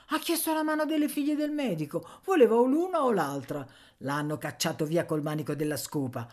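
popolana che spettegola, voce più acuta; Nanna, pettegola concitata. Acted. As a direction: racconta lo scandalo con voce alta e animata. Personaggio di Nanna che racconta. (pettegola concitata, voce acuta)

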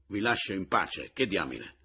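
usual speaking voice

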